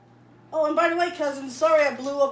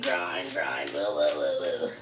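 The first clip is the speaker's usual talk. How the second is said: far lower growling grind; deep growl; slower than usual. Acted. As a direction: growl it like a machine monster.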